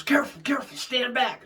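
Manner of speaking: high, loud urgent warning. Retold or imitated. Friend in the tree warns narrator away: urgent shout. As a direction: call out the danger, keep him back.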